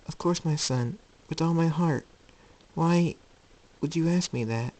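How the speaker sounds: high, tender motherly voice; loving mother, puzzled. Acted. Luna answering her doubting son, tender and puzzled. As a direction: reassure gently, then wonder aloud.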